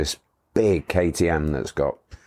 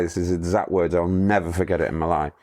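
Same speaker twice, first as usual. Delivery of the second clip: usual speaking voice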